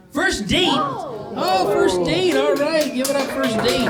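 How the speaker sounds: high-pitched, whooping cheer; excited, high-pitched shout-out; excited crowd hype. Real, not acted. Hyping the crowd for a couple.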